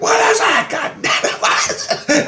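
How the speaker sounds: very high strained near-whisper; desperate to be let in; frantic asking. Retold or imitated. Desperate to know.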